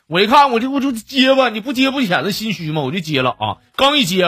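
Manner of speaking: ordinary talk, nothing marked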